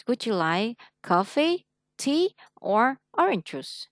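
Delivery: slow, each choice rising, "orange juice" falling; list tune up up down. Shown as a demonstration. Teacher showing the choice-question tune to a student, up then down. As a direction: rise on "coffee", "tea", then drop on "orange juice". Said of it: "lên, lên, xuống cái, cái cuối cùng là xuống".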